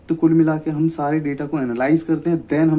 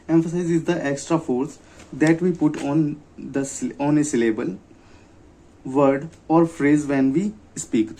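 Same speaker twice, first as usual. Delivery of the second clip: slow dictation; much slower than usual. Shown as a demonstration. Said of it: "definition read slowly".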